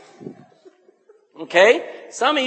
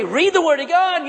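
passionate command; 8 semitones higher than usual. Shown as a demonstration. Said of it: "read it with passion and with power".

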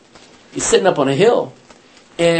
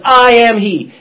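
loud and high, owning it; bold declaration; 7 semitones higher, 9 dB louder, slower than usual. Retold or imitated. Jesus answers the soldiers, loud bold declaration. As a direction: announce yourself to the mob, loud.

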